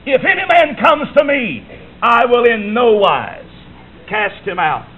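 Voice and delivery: quoting God's call, drawing out the middle slowly; emphatic quote. Acted. Preacher to congregation: God's promise, slowly and emphatically. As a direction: lean hard on "man", then slow right down.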